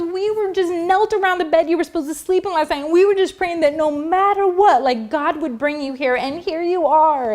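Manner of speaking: high-pitched mimicked outpouring; tearful woman. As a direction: gush like a weepy church lady. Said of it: "wiping their eyes".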